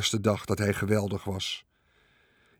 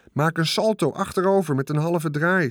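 higher shouted order, only a bit louder; shouted command; 7 semitones higher, 3 dB louder than usual. A narrator's reading. Charlotte shouts a stunt order to Wilbur, lightly shouted. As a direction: call the stunt out like a coach. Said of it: "schreeuwde Charlotte".

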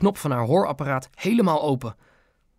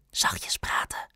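hushed warning in a whisper; 7 dB quieter, slower than usual. A narrator's reading. Ben tells grandma to talk softly, whispering. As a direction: whisper it to quieten someone.

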